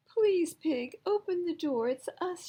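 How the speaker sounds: high, loud begging at the door; pleading shout. A narrator's reading. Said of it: "shouted Frog and Duck".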